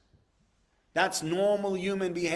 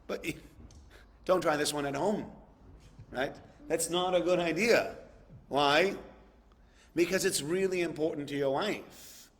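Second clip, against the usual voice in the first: ordinary delivery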